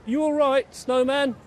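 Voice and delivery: high cautious question; Tiny creeping up to the snow pile; nervous call. A narrator's reading. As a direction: ask nervously. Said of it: "creeping nervously".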